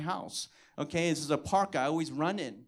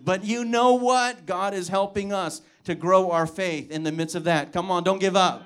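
rousing exhortation; 7 dB louder than usual. Real, not acted. Preaching exhortation.

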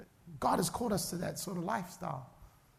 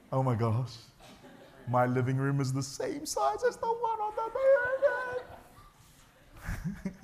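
mock spooked.

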